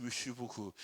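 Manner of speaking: soft, pursed-lip French Merci beaucoup; mock French. Shown as a demonstration. Preacher imitating how Frenchmen speak, pursed French. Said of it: "O francês fala assim".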